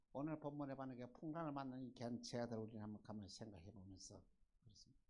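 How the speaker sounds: ordinary talk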